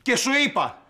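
high-pitched exasperated outburst; furious scolding. Acted. Boss to employee who lost the manuscript, furious scolding. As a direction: scold in a rising, high shout.

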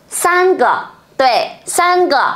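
San ge said high then repeated slowly; Chinese pronunciation demo. Shown as a demonstration. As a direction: teach San ge with a high first take. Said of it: "ba cái thì bọn mình sẽ nói như nào".

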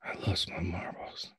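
faint, voiceless whisper; confused father under his breath. Acted. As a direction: whisper a confession to your son. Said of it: "Eugene whispering".

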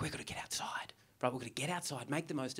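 whispered urgent aside; mock whisper. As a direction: hush it like a secret plan. Voicing eager Melburnians.

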